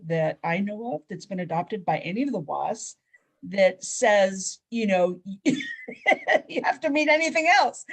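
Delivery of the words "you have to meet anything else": high, loud, laughed-out words; real amused laugh breaking into speech. Real, not acted. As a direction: laugh through the words, pitch jumping up. Laughs at absence of any criteria.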